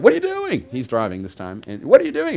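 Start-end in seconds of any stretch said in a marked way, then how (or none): 0.0-0.7 mock panicked high-pitched yelp; Tammy freaking out. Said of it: "Tammy freaks out". 1.8-2.3 mock panicked high-pitched yelp; Tammy freaking out. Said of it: "Tammy freaks out".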